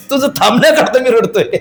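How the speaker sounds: a laugh breaking into the line. Retold or imitated. Swami answering the weeping widow, laughing. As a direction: chuckle over the punchline.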